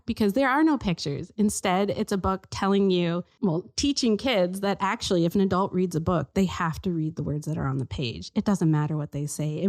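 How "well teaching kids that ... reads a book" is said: normal talking voice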